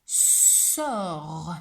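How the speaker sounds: "Sort" with a drawn-out s; dictated word. Shown as a demonstration. Teacher dictates a word to a pupil, "Sort" drawn out. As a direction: stretch the sounds of "Sort". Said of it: "il y a trois sons".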